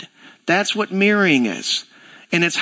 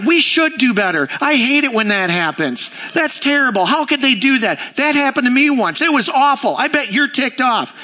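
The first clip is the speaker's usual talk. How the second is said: higher, lively, mock-indignant clerk; hammed-up commiserating desk agent; sympathetic outrage; 8 semitones higher than usual. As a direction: gush along with the customer's anger. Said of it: "She's like".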